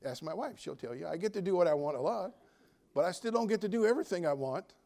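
ordinary talk, nothing marked

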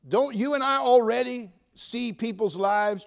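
ordinary talk, nothing marked

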